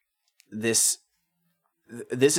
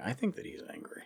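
soft unvoiced whisper; 3 semitones higher, 12 dB quieter, faster than usual. Shown as a demonstration. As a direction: whisper it like a secret. Whispered take of same line.

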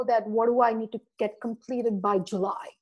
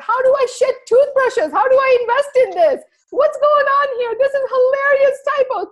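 much higher and louder, incredulous and amused; amused customers; 12 semitones higher, 8 dB louder than usual. Retold or imitated. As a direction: play the baffled, amused readers. Quoting amused customer emails.

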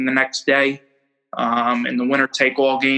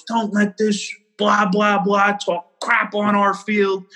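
higher, slow imitation of a riled-up coach; mock coach rant; 5 semitones higher, slower than usual. Rival coach rallying his dugout, retold as a mock rant. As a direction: draw out a blah-blah pep talk, pitched up.

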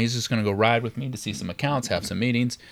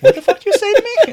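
high, loud mock outrage; imitated angry challenge; outraged cop; 9 dB louder than usual. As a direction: fire it back as if insulted. Cop reacts angrily to backtalk.

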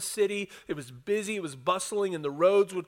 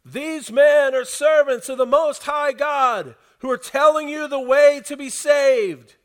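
high, very loud drawn-out shout; 8 semitones higher, 9 dB louder than usual. Acted. Possessed slave girl following Paul, shouting. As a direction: shout it out high for everyone to hear.

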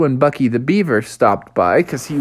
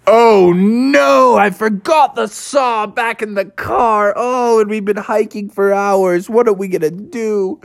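higher, alarmed dad voice; a dad realizing his blunder; dismayed father frog; 8 semitones higher than usual. Acted. Father frog panicking to family about the forgotten saw.

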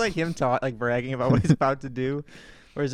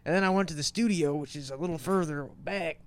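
a hint of a tired [name]; imitating [name]; 4 semitones higher than usual. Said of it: "just imagining his voice where he's like".

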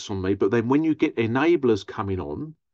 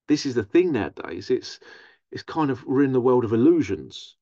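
ordinary talk, nothing marked

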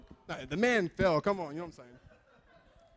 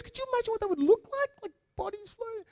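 put-on Indian accent. Coworker picturing floating bodies, Indian accent. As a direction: wonder aloud in an Indian accent. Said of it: "With a very pronounced Indian accent".

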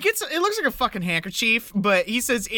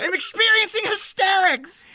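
much higher, overdone hysterical voice; hammed-up hysterics, higher; mock hysterics; 9 semitones higher than usual. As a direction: announce your hysterics theatrically. Playing hysterical.

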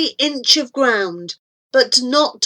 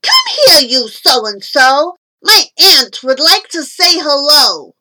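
put-on loud, high boy calling out; raised, high-pitched yell; 4 semitones higher, 6 dB louder than usual. A narrator's reading. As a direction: holler an order across the yard. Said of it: "Roy yelled".

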